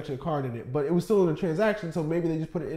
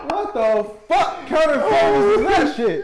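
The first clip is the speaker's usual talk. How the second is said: slow, loud high incredulous rant; mock outrage; 11 semitones higher, 9 dB louder, much slower than usual. At the drive-thru, ranting about the speaker, incredulous. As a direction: drag it out in disbelief.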